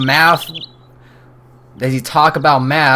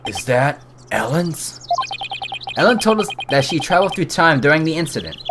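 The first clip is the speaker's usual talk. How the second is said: normal talking voice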